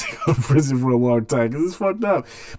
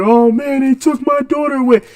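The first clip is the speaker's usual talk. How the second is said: mock sobbing plea, pitched way up and louder; imitating a crying father; 13 semitones higher, 5 dB louder than usual. Father in the news video, mimicked crying high. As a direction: play the grieving dad, voice lifted high and breaking. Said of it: "And he was crying".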